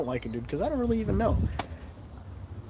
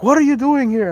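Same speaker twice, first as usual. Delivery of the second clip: mock security guard; 12 semitones higher, 6 dB louder than usual. Imagined security guard catching him.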